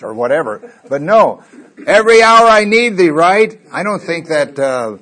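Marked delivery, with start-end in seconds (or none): 1.9-3.1 hymn line belted. Acted. Hymn line burst out in sermon.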